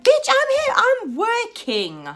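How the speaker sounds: high-pitched, indignant comeback; squealed mock outrage; exasperated retort. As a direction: snap back at him, stretching the last word. Acted retort to angry customer.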